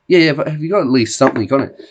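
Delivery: fast pressing question; quick worried question. Retold or imitated. Pharmacist pressing the bleeding customer, fast. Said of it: "I'm like".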